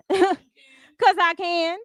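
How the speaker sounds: high, slow, with a laugh; drawn-out cheeky retort. Real, not acted. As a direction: stretch it out tauntingly through a laugh.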